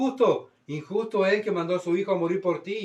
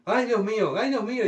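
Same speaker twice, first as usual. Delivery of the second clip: panicked plea; 6 semitones higher, 3 dB louder than usual. Pretend. Dying worldly person cries to God.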